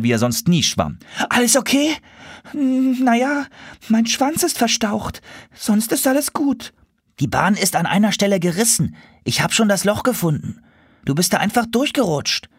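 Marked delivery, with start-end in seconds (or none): 1.3-2.0 very high, anxious question; worried boy seahorse. Acted. Timo rushes to hurt sister. 2.5-6.7 very high, halting then brave; hurt but brave Sophie. Acted. Sophie answers after hurting tail. 7.2-12.5 put-on high boy seahorse voice, matter-of-fact; Timo explaining. Timo explains the torn slide.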